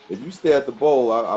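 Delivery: ordinary talk, nothing marked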